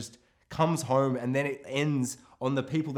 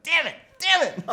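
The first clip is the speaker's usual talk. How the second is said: much higher, louder hammed-up frustration; mock frustrated outburst; 6 dB louder than usual. Hearing of six more weeks of lockdown, mock cursing loudly. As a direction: curse the lockdown news in mock despair. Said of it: "And I'm like".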